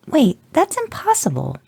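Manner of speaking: put-on very high, startled child voice; a little girl who can't believe it; disbelieving girl. A narrator's reading. Daughter gasping at the bloody heroes, very high. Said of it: "your daughter started".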